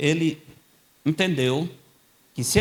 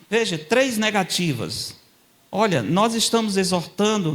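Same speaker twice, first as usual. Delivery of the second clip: high, loud fervent preaching; preaching at full force; 9 semitones higher, 5 dB louder than usual. Acted. Preacher defending Paul to the congregation, fervent and loud. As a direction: press each negation home to the church.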